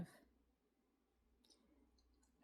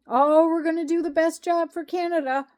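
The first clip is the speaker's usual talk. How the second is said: sarcastic, higher and louder parody of a politician; mocking quote; 6 semitones higher, 5 dB louder than usual. Mocking the government's promise, sarcastic higher and louder. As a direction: sneer out their slogan.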